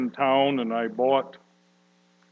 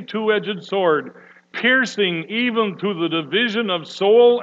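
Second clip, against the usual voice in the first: measured, louder preaching read; scripture reading; 4 semitones higher, 4 dB louder than usual. Acted. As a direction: read the Word with weight. Pastor reads scripture aloud.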